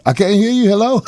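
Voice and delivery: high, loud mock can't-hear-you act; fake bad connection. Husband faking a bad phone line to the comedian, high loud mock. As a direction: pretend the phone line is breaking up. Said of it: "He said".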